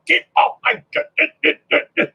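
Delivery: comic stomping knight, broken up by stuttering thuds; hammed-up knight, jerky and high at first; grumpy Nurgle knight. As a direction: play a knight shooing Marines off the lawn. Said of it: "a Nurgle knight walking up to a bunch of Marines going".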